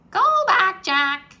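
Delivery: high, loud parrot call. A narrator's reading. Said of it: "she said".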